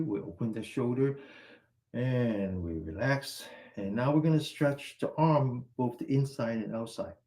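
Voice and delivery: usual speaking voice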